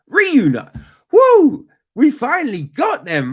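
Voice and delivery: high loud whoop; triumphant. Real, not acted. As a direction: celebrate finally getting the word. Triumph at finding the word.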